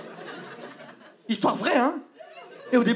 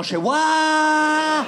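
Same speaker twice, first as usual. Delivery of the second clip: shocked exclamation; 4 semitones higher, much slower than usual. Retold or imitated. Reacting to director's exorcism.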